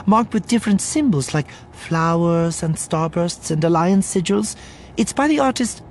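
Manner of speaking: high, hurried anxious rambling; eager spokeswoman running on nervously; nervous publicist figure. Acted. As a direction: fuss over details in a high voice.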